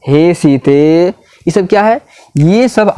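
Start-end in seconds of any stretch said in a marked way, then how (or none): 0.1-1.1 हे सीते called out very slowly, vowels held long; prolonged call. Shown as a demonstration. Teacher demonstrating a pluta vowel with हे सीते. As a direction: draw out हे सीते like calling from afar. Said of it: "सबसे अधिक समय".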